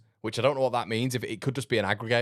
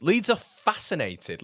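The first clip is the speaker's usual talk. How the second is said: mock awe; 9 semitones higher, slower than usual. Mocking Leeds interest.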